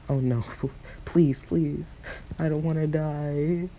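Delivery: high, drawn-out pleading whine; hammed-up whimpering plea; mock crying. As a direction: beg for your life, half-heartedly tearful. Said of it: "he was, like, crying".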